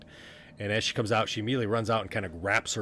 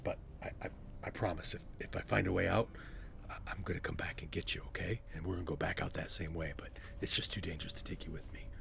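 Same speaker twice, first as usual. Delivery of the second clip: gentle, serious Garan; 2 semitones lower, 5 dB quieter than usual. Acted. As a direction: break it to a friend quietly. Garan promises Corky he will return.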